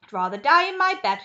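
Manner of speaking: loud stubborn shout. Acted. Grandma Josephine shouts refusing the lift, loud. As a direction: refuse at full volume. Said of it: "shouted Grandma Josephine".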